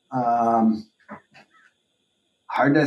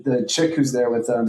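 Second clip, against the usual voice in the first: ordinary talk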